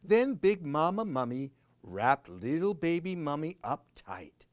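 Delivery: ordinary delivery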